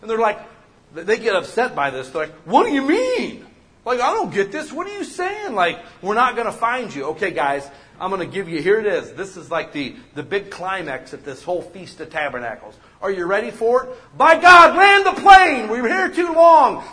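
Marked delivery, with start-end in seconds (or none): 2.4-7.1 higher, louder baffled crowd voice; confused crowd. Retold or imitated. Preacher voicing Pharisees baffled by Jesus, high and louder. 14.2-16.8 higher, very loud urging; loud shout. Real, not acted. Preacher shouting to congregation to wrap up, loud and high.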